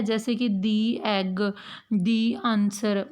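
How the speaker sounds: दी एग and दी आंसर with a long ee, slow; the as thee. Shown as a demonstration. Teacher demonstrating the article before vowels, slow. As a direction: stretch out दी before the vowel word. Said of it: "दी बोला जाता है".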